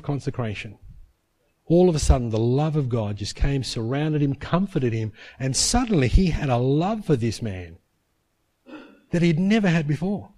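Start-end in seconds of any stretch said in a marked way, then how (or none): none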